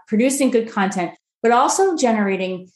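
ordinary talk, nothing marked

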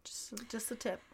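hushed, whispered quip; whispered joke aside. Real, not acted. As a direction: slip it in under your breath. Murmured suggestive joke aside.

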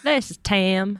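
a light Southern drawl on "This is Tim"; imitating Tim Cook with a mild Southern twang; Southern accent. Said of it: "is hard to say in a Southern accent".

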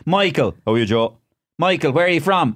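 radio phone-in host. Retold or imitated. Host invites callers to do impressions, high and insistent. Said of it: "Liveline went".

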